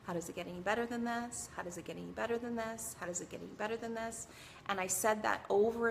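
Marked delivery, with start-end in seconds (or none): 0.0-4.3 quick repeated mantra. Shown as a demonstration. Mom leading the panicked family in a mantra, fast. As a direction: rattle the phrase off quickly, over and over. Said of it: "Say this with me".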